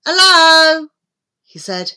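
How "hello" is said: loud, raised call at a door; higher-pitched, carrying hail. A narrator's reading. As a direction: call out hello to see who's there. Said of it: "He knocked on the door".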